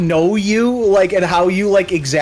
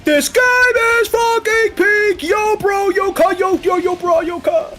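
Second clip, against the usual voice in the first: much higher, slow, over-the-top bro hype; hammed-up hype; slower than usual. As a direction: scream it like a hyped bro. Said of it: "mocking hype chant".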